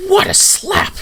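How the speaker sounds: high, loud, thrilled exclamation; delighted king. Acted. King praising the slap, high delighted yelp. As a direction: squeal it with glee at the blow. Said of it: "He goes".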